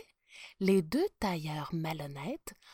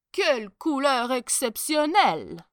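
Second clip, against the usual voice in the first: higher, louder marvelling voice; feigned enthusiasm; 5 semitones higher, 6 dB louder than usual. A narrator's reading. Prime minister pretending to admire the colour, enthusiastic. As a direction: marvel loudly to cover your doubt. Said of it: "tous admirèrent l'étoffe avec enthousiasme".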